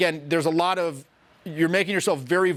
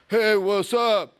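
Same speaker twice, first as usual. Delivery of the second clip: limp half-step greeting; much slower than usual. Retold or imitated. Coach mocks students' weak opener, slow and limp. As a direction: drag out a lifeless hello. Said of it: "You're going up".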